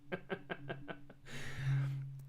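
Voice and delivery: real soft, breathy laugh; wheezy quiet giggles. Real, not acted. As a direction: laugh away from full voice. Laughing near the microphone.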